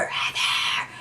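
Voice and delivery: breathy, urgent whisper of a name; whispered call. Retold or imitated. Calling to passed-out friend on the stairs, whispered. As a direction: call out to her under your breath. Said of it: "I'm trying to whisper".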